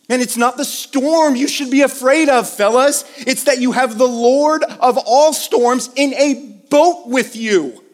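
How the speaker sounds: rising exhortation. Real, not acted. Preacher urging the men not to fear the storm, loudly.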